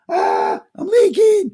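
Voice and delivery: hammed-up high, loud wail of strain; mock distress. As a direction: whine it like a sponge about to burst. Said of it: "You're like".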